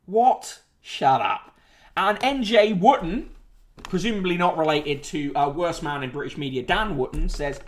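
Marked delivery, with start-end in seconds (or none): none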